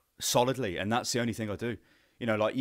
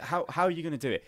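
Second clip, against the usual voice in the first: usual speaking voice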